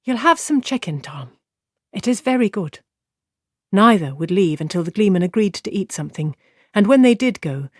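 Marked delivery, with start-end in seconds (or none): 0.0-2.8 firm and pleading. A narrator's reading. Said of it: "a mixture of firmness and pleading".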